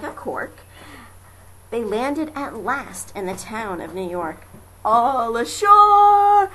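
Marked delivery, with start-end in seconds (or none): 4.8-6.5 loud, high drawn-out call of the crew; shouted call. A narrator's reading. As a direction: bellow it like sailors landing. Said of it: "the men shouted".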